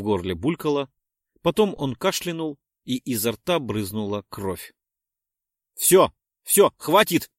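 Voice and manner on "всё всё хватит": loud, higher urgent stop; almost shout it; near shout. A narrator's reading. Willis nearly shouts at the narrator to stop. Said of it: "чуть ли не прокричал Виллис".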